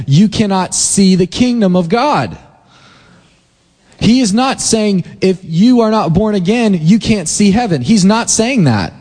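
high-pitched, driving sermon cadence; impassioned preaching. Acted. Pastor preaching passionately to his congregation about the kingdom. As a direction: preach it with rising fire.

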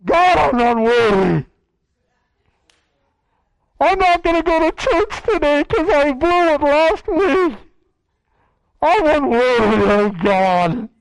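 loud, wailing self-pity to God; hammed-up despairing believer crying out; mock wailing prayer. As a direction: wail your unworthiness like a ham actor. Acted groveling prayer.

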